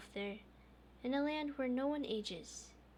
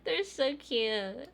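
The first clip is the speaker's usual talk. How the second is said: slow high cutesy coo; 4 semitones higher, 8 dB louder, much slower than usual. Real, not acted. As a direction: gush over something adorable. Coos while laughing.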